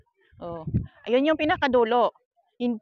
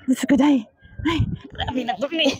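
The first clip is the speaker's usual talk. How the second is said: frightened exclaim. Real, not acted.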